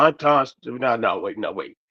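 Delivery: ordinary talk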